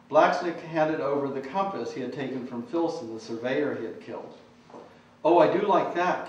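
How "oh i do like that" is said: louder, higher delighted cry; delighted exclaim. Acted. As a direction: exclaim with delight over a gift. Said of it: "Kiss Me exclaimed".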